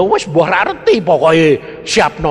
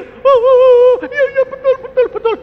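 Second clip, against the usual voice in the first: high, squeaky mock child sobbing; crying like a child; 7 dB louder than usual. The husband retold admitting guilt, mock childish crying. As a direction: whimper it out like a little kid caving in. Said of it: "Nangis kayak anak kecil".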